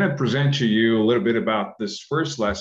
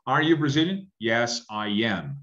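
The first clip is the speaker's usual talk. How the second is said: slow model answer. Shown as a demonstration. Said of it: "For example".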